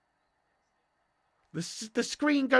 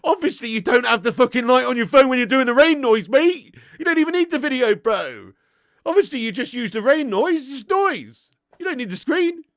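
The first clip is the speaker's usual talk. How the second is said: louder, higher, laughing disbelief; exasperated loud; 4 semitones higher, 9 dB louder than usual. Real, not acted. Streamer mocking a viewer's rain-noise habit, loud exasperated. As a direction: hammer home the obvious, amused.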